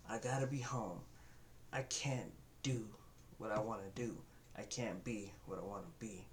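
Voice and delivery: put-on quiet discouraged child. Child voicing discouraged limits.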